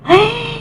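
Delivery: a sudden high gasp of shock; sharp gasp. Real, not acted. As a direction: catch your breath in shock. Shocked at husband's words.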